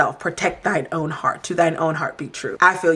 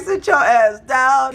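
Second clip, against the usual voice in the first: slow, higher-pitched imitated command; mock yell; 7 semitones higher, 3 dB louder, slower than usual. Housemate ordering rival to sit down, mock yell. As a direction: draw out the order, hammed-up. Repeats Gabo's yell at Huey.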